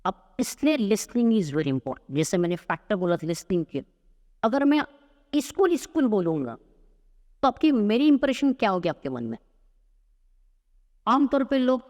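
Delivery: ordinary talk, nothing marked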